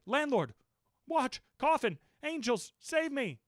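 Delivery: high, loud panicked cry for the landlord; shouting for help. A narrator's reading. Narrator yells to the landlord for help, panicked. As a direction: yell for rescue in terror. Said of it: "shouted I".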